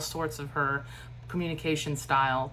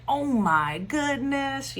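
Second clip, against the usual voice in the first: high, slow, excited gush; gushing with friends; 9 semitones higher, 4 dB louder, much slower than usual. Shown as a demonstration. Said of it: "really putting a different inflection".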